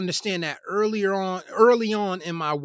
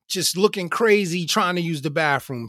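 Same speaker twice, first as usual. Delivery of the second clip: usual speaking voice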